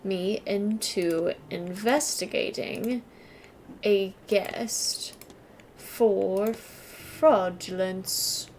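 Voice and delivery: slow, halting, word by word, working out the blanks; slow puzzle-solving read. Shown as a demonstration. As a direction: piece "me into investigating a guest for fraudulence" together slowly, pausing between the words. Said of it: "Mister Toussaint's goal is to".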